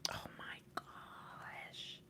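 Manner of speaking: slow, stretched whisper of awe; awed whisper. Real, not acted. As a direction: breathe it out in wonder. Awed reaction to the cards.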